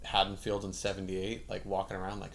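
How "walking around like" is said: usual speaking voice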